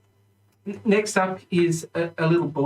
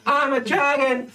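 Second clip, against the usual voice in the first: put-on high, gleeful little kid; excited child's boast; proud dragonborn boy; 9 semitones higher than usual. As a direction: announce it proudly, pitched up. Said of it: "He's like".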